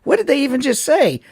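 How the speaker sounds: puzzled, going up high; baffled. Retold or imitated. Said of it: "I'm like".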